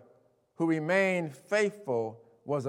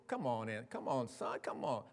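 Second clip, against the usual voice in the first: soft, lower pleading; coaxing father; 6 semitones lower, 11 dB quieter than usual. Retold or imitated. Father entreating angry son, soft lower coaxing. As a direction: gently coax him inside.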